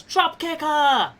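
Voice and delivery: high, drawn-out mock yell; audience yell. Game master voicing a heckling audience, high stretched yell. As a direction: call it out high and slow like a fan in the crowd. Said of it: "for the audience yells".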